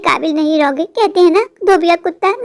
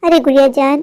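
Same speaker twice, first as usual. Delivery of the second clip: normal talking voice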